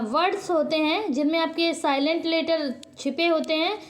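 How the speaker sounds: normal talking voice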